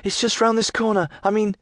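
high, light lass voice; young woman's voice. Acted. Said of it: "she says".

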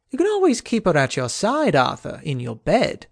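sneering Kay. Acted. Kay sneers at Arthur's lady.

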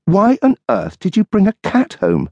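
dry retort as the wife; wife's voice. Acted. Wife waiting up for her husband, tart retort.

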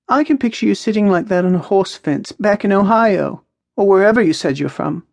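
high-pitched character voice for Maya; Maya, teasing. Acted. Maya teases Jack about Ohio, high and lively.

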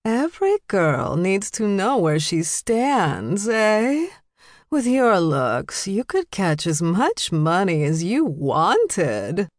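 slow, swooping drawl with wide pitch swings; slurred, meddling socialite; tipsy older woman. A narrator's reading.

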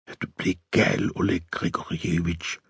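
very soft near-whisper; barely voiced, shocked question; hushed disbelief. A narrator's reading. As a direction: whisper it, aghast. Woman aghast at his defeatist talk.

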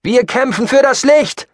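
loud, high battle cry; fervent call. Acted. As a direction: shout it from the crowd. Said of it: "kam noch ein einzelner Ruf hinterher". (lauter, hoher Kampfruf)

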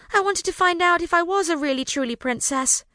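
high, plaintive wail. A narrator's reading. Rosalind wailing to her parents about the test. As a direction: whine it like a let-down child. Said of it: "Rosalind wailed".

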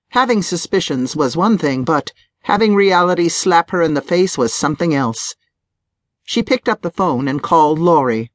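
usual speaking voice

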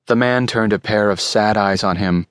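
ordinary delivery

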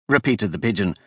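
ordinary delivery, nothing marked

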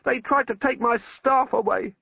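high-pitched, restrained scream; mild scream of outrage. A narrator's reading. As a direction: pitch it right up, as if over wind. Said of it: "Ipslaw was screaming above the noise".

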